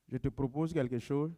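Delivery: normal talking voice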